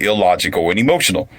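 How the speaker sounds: usual speaking voice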